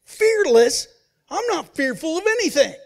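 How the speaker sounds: put-on older brother scoffing loudly; indignant retort. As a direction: bristle and throw the word back. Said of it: "the older brother goes".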